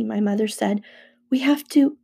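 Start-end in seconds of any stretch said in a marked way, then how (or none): 1.3-2.0 higher, faltering mother's voice. A narrator's reading. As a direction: let the words falter and break off. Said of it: "her voice faltered".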